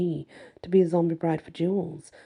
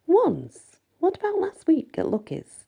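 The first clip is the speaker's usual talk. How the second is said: incredulous; 12 semitones higher than usual. A narrator's reading. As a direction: toss his word back at him in disbelief. Heroine teases Knox, disbelieving.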